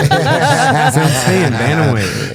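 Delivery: slow high laughing echo. Real, not acted. Host laughs at the announcer bit, laughing. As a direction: laugh out the word bantamweight.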